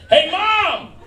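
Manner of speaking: high-pitched holler for Mom; calling out to Mom. Acted. Older woman hollers to her mother, excited calling out. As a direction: yell it across to someone out of sight.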